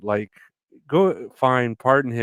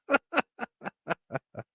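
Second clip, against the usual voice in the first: high laugh; 14 semitones higher, 6 dB quieter than usual. Real, not acted. Laugh alone.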